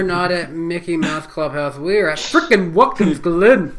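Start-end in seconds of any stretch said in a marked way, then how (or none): 1.8-3.8 goofy put-on accent. Repeating the silly voice.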